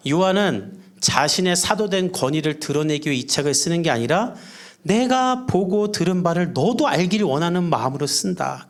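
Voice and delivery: normal talking voice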